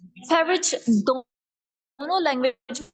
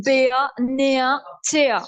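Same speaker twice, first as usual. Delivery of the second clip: British "beer, near, tear" ending in "ah"; British accent; much slower than usual. Shown as a demonstration. Teacher converts the word list, British accent. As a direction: drop each final R, British. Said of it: "British में convert करूं".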